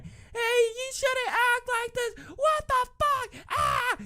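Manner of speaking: very high, shrill mocking imitation of a yelling mother; mock screaming mom. Mom yelling at kids in the mosh pit, mock high screech. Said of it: "the mom screaming at people".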